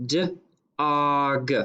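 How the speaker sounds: "d-og" split, onset then rime; segmented syllable. Shown as a demonstration. Teacher segmenting "d-og" for spellers. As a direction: tap "d-og" in two parts. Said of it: "then we're going to pound it".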